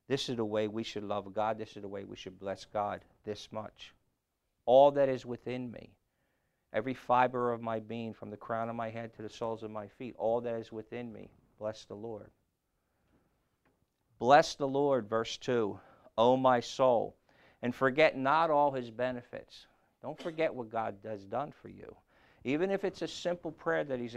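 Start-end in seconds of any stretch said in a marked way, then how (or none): 4.6-5.9 loud, emphatic verse quote; scripture reading. Acted. Preacher repeating the psalm, loud and emphatic. 14.2-19.7 loud proclaimed verse; scripture reading. Acted. Preacher reading verse two, loud proclamation.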